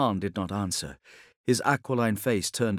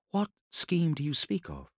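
higher, softer woman's voice; questioning Ilya; 6 semitones higher, 5 dB quieter than usual. Acted. Ilya questions the Khan about the scheme, softly puzzled. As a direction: ask softly in Ilya's higher voice.

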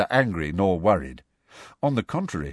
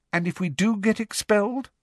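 high-pitched, questioning lilt; quizzical; 14 semitones higher than usual. A narrator's reading. Girl asking about being expelled, quizzical. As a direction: pose it as a high, curious query. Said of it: "a quizzical look".

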